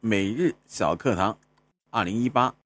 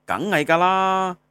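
acted dialogue retort; 7 semitones higher, 5 dB louder than usual. Answering the question about renewing ID, exaggerated high-pitched retort. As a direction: toss back an animated Cantonese retort. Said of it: "我们来看一下粤语日常用语对话".

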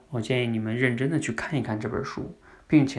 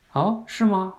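dad's gentle surprise; 8 semitones higher, slower than usual. Retold or imitated. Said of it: "爸爸应该说什么呢".

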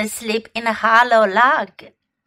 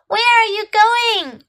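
much higher, curious question; small bear voice; 11 semitones higher, 4 dB louder than usual. Acted. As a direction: ask like a wondering little bear. Muslin asks, much higher.

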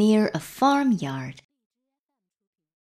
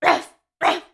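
two short, high barks; quick, high "ruff" barks; dog bark; 9 semitones higher, 4 dB louder than usual. A narrator's reading. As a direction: imitate a small yappy dog. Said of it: "barked the dog".

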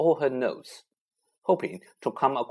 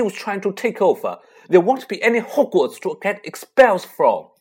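higher and louder, urgent and fierce; impassioned outburst; 7 semitones higher, 9 dB louder than usual. A narrator's reading. Harry insisting to his friends he must go: fierce outburst. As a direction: pour out desperate anger.

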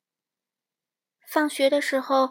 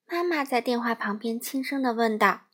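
ordinary delivery, nothing marked

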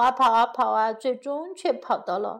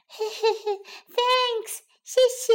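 giggling gingerbread man; 9 semitones higher than usual. Acted. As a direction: giggle your thanks like a tiny cookie man.

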